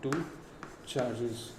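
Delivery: very slow, long pauses between words; halting dictation. Shown as a demonstration. Teacher pausing so students can copy the law. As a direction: spell it out piece by piece. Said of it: "dictating with long pauses".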